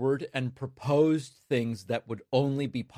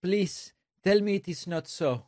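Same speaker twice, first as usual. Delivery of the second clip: higher-pitched mock Mexican accent; imitating Mexican-accented English, pitched up; mimicked Mexican accent; 6 semitones higher than usual. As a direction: plead in a put-on Mexican accent. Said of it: "doing his best impression of a Mexican".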